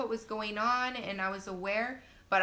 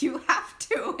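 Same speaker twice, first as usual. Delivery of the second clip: loud, raised-pitch mock insistence; imitated insistent nurse; 7 semitones higher, 9 dB louder than usual. Nurse arguing with labouring mom, loud insistence. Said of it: "arguing with my nurse".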